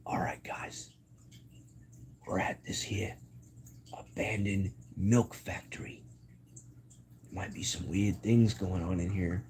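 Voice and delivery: breathy near-whisper, halting between phrases; hushed explorer. Pretend. Streamer playing an explorer in an abandoned building, hushed pretend suspense. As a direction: creep in softly, pretending to be spooked. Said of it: "go in there and be like".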